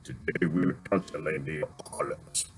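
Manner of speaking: deep low rumble, barely above a murmur. Retold or imitated. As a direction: drop the voice low and heavy like a big actor. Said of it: "he got a deep voice too".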